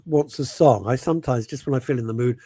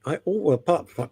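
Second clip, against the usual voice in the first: normal talking voice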